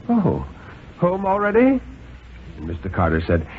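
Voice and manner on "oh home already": high surprised wife's voice. Acted. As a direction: caught off guard, pitch jumps up. Missus Carter was surprised.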